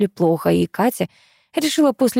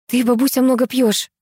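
mildly barked, raised-pitch reproach; 6 semitones higher, 3 dB louder than usual. A narrator's reading. Katya runs in at the noise, barking at granny. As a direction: snap at granny, without real shouting. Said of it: "рявкнула прибежавшая на шум Катя".

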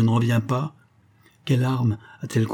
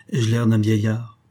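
plain reading voice

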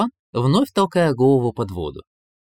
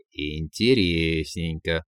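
slow, low, stretched-out musing; drawn out; 7 semitones lower, 4 dB quieter, much slower than usual. A narrator's reading. Koschei peers into the cellar, drawling slowly. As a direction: stretch every syllable while peering at something curious. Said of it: "протянул Кощей".

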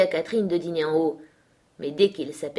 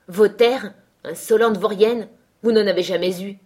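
loud, higher, indignant outburst; incensed master putting the girl down; outraged retort; 3 semitones higher, 8 dB louder than usual. A narrator's reading. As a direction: throw the insult back loudly. Furious quarrel over stolen lands.

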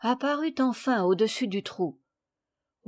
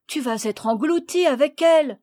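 loud, higher, alarmed warning; raised, urgent cry; 5 semitones higher, 5 dB louder than usual. A narrator's reading. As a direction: shout a warning up the tree. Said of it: "cria Léon".

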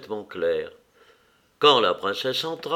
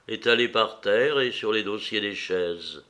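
ordinary delivery, nothing marked

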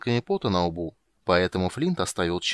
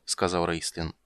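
ordinary delivery, nothing marked

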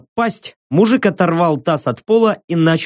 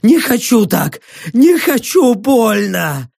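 high, wailing protest; panicked pleading; 6 semitones higher, slower than usual. Acted. Trembling prisoner refuses such a death, wailing. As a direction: beg in a high, frightened cry.

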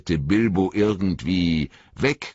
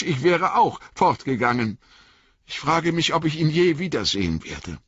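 higher, quicker hobbit voice; young hobbit pouring out his feelings, pitched up; indignant, wistful young Frodo; 8 semitones higher than usual. A narrator's reading. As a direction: let outrage turn into longing.

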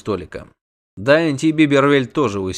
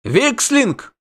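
high, alarmed exclamation; cried out; 12 semitones higher than usual. A narrator's reading. Innkeeper recognises the monster's name, crying out. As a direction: cry it out, pitched high. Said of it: "выкрикнул трактирщик".

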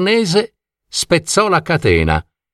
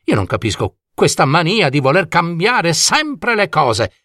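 put-on higher-pitched, theatrical old coachman in a huff; indignant dragon coachman; 5 semitones higher than usual. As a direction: grumble grandly, building to a mock proclamation. Coachman complains about change. (vecchio cocchiere indignato, acuto e teatrale)

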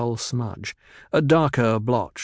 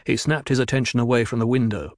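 ordinary delivery, nothing marked

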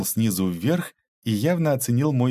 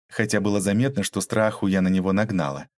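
ordinary delivery, nothing marked

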